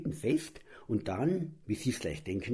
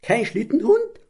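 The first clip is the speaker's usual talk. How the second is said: high, loud indignant cry; boy outraged, shouting the question; roar; 12 semitones higher, 9 dB louder than usual. Acted. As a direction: bellow it in disbelief. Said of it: "so brüllte Borki".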